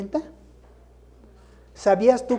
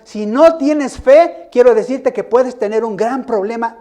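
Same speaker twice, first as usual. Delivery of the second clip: loud, rising sermon build; fiery preaching; 3 semitones higher, 8 dB louder than usual. Acted. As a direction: hammer each phrase home to the congregation. Preaching climax.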